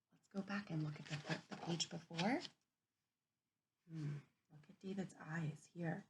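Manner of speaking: soft, half-whispered noticing; hushed think-aloud. Real, not acted. Teacher quietly pointing out pictures to children. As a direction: murmur it like sharing a discovery.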